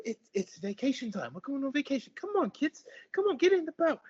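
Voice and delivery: high, loud bustling mother; cheery otter mom. Acted. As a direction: round everyone up with forced cheer. GM voices the mother.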